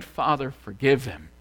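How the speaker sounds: ordinary delivery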